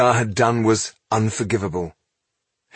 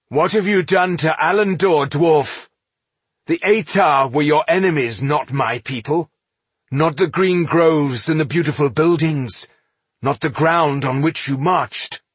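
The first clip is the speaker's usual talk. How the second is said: higher, forceful elf accuser; menacing accusation; 7 semitones higher, 4 dB louder than usual. A narrator's reading. Elf confronts dwarf over his ravaged homeland, menacing accusation. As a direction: press the charge hard, pitched up. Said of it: "regarded Ginsgar with menace".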